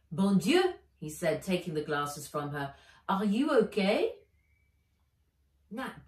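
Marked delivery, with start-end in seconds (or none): 0.1-0.7 a loud French gasp of concern; French exclamation. Acted. Philippe startled over Nat, loud French. 3.1-4.2 louder, caring Philippe voice; concerned Frenchman. Acted. Philippe checking on Nat after the game.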